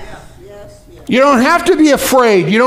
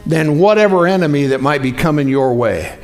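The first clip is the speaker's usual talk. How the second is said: ordinary talk, nothing marked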